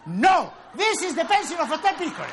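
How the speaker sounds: comic teacher yelling at the class; mock shout. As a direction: bellow the answer like a mad teacher.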